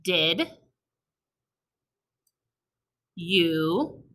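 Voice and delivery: slow, word by word dictation. Shown as a demonstration. Teacher dictating to kids word by word slowly. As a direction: say each word slowly for writing. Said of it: "you're going to write".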